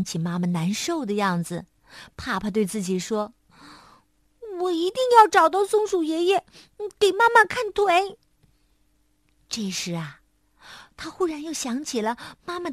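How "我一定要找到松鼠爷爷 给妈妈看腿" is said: higher, firm resolve; a small child making up his mind; determined little monkey. A narrator's reading. Little monkey tells himself he must find help, higher and determined.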